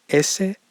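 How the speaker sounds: "S" pronounced toward "eshe"; Spanish S. Shown as a demonstration. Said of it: "un sonido más sh".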